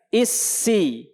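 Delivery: Isi with a thin light s; model Isi. Shown as a demonstration. Teacher models thin Isi for the class.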